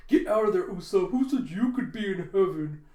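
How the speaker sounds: loud, jealous mock outburst; Shitji. Freaking out at Usopp riding with the women, loud. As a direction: yell in hammed-up outrage. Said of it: "Shitji freaking out".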